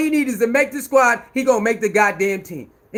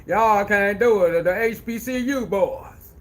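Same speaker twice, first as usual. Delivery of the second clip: mocking impression; 2 semitones lower, slower than usual. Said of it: "why the hell you talking like that".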